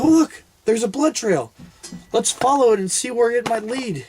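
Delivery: high-pitched mock-innocent excitement; feigned discovery. Hunter leading buddy to blood trail, feigned discovery. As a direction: point it out as if it were news to you.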